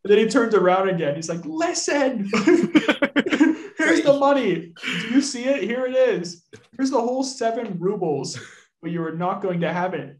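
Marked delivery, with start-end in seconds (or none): 1.4-8.4 high, loud mock exasperation with laughter; hammed-up shouting, breaking into laughs; frantic outburst. Master waving wages at his butler, frantic hammed-up outburst.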